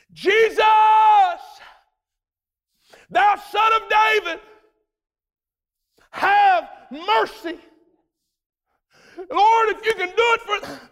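high pleading shout, slowing on have mercy; desperate shout. A narrator's reading. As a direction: shout for mercy over the crowd. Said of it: "pitching a fit... praising fit".

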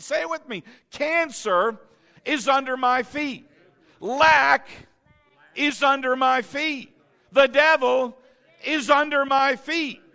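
fired-up preaching after the crowd seems flat; excited. Acted. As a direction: rouse the flock with fire. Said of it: "You just don't seem that excited".

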